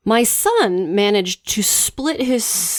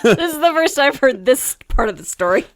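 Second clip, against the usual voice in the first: laughing through the words, higher and louder; can barely get it out for laughing; 7 semitones higher, 3 dB louder than usual. Real, not acted. Wife hearing the diarrhea story for the first time, laughing. Hears new detail, laughing.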